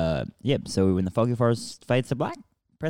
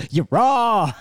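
high, loud mock yell; mock shout; 12 semitones higher, 9 dB louder than usual. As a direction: shout it like a fan in the crowd.

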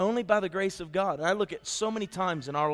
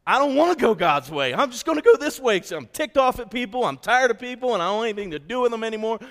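sulky rebellion; 5 semitones higher, 5 dB louder than usual. Retold or imitated. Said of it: "when you wanna go a different way".

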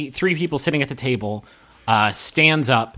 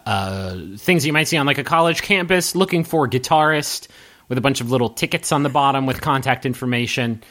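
ordinary talk, nothing marked

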